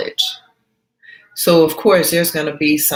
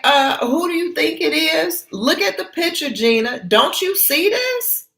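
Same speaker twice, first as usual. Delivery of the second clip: exasperated friend; 9 semitones higher than usual. Acted. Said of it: "So Ann is like".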